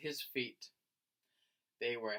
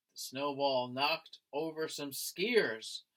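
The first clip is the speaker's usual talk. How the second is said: usual speaking voice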